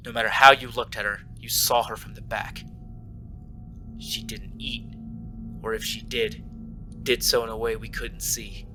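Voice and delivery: even, measured horror story read; story narration. Acted.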